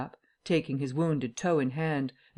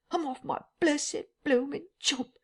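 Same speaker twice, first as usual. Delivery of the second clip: distressed tramp; 12 semitones higher than usual. Acted. Tramp repeats his lament.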